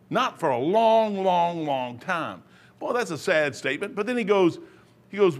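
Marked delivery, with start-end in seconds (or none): 0.1-2.4 slowly stretching out long, long, long; drawn-out solemn quote. Retold or imitated. Retelling the pastor's words, slow and drawn out.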